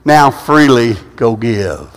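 very slow, low and weighty; solemn scripture quote. Retold or imitated. Pastor quoting Jesus to the congregation, slow and solemn. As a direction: draw each word out like scripture. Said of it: "he said".

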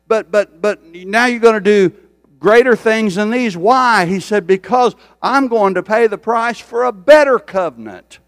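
loud, high-pitched preaching climb; rising revival preacher cry; full-force preaching. Acted. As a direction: preach it out to the back row.

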